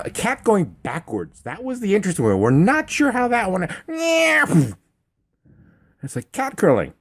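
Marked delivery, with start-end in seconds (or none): 3.9-4.7 much higher squeaky mock meow; high cartoon cat meow; imitated meow. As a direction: mew like a startled cat. Cat going backwards.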